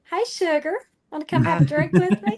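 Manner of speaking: put-on Southern belle accent luring them in; heavy Southern accent. [name] inviting brothel spirits, heavy Southern accent. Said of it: "it comes a little bit heavy".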